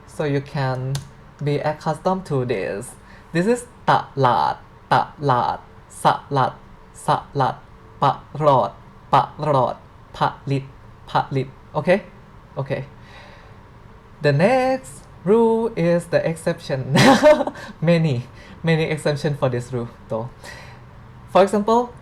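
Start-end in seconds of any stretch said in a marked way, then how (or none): 3.3-11.5 "ta, lat, sa, lat, pa, rod, pa, lit" chanted as a repeat drill; Thai drill. Shown as a demonstration. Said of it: "So repeat that again". 17.0-17.8 amused laugh. Real, not acted. Laughs at the many exceptions.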